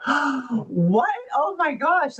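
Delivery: high, delighted gasp of disbelief; thrilled surprise. Retold or imitated. Mom hearing about the book, thrilled and amazed. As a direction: squeal it like wonderful news. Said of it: "she was so thrilled".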